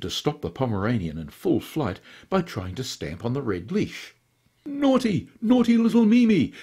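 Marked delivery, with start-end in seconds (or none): 4.7-6.5 high, flustered scolding bleat; a fop flapping after his runaway dog. A narrator's reading. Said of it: "bleated the flustered Pretty John".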